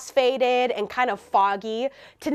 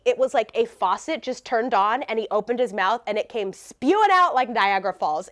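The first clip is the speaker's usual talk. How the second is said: plain reading voice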